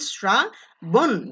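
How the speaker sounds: শ্রাবণ drawn out slowly, srabon; শ্রাবণ with a dental s, slowly; teacher models শ্রাবণ as srabon, very slow; slow pronunciation demo. Shown as a demonstration. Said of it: "রি থাকলে দন্ত স হয়ে যায়".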